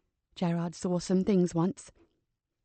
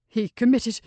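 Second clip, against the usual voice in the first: voice breaking off; 2 semitones higher, 5 dB louder than usual. Acted. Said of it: "He swallowed hard, unable to continue".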